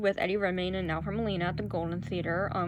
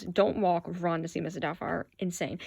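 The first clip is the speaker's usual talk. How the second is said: usual speaking voice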